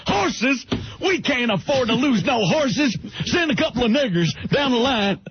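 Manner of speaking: outraged cowboy boss; Slim Pickens voice. Retold or imitated. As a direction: boss refusing to risk horses. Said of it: "Oh, Slim Pickens".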